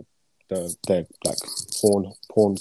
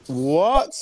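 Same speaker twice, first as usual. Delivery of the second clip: surprised; 6 semitones higher, 3 dB louder than usual. Real, not acted. Reacting to shocking voice note.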